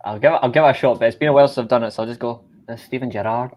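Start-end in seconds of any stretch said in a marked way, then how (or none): 2.7-3.5 half-hearted Scouse accent; shitty Scouse accent. Shown as a demonstration. Friend gives Scouse a shot after a while, shaky Scouse attempt. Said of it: "a shitty Scouse accent".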